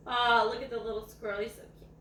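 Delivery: plain reading voice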